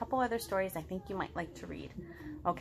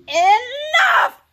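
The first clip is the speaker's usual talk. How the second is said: high, loud burst of anger; frustrated shout; 14 semitones higher, 15 dB louder than usual. Acted. Gerald gives up and yells at Piggy. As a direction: snap at the whole thing. Gerald loses his temper.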